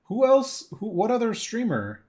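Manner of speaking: high, loud surprised question. Real, not acted. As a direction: jump up in surprise. Reacting to chat comment.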